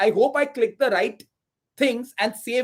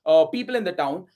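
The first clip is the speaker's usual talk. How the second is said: normal talking voice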